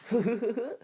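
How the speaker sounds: high giggling clown laugh; a sly creepy giggle. Acted. Clown appears in drain.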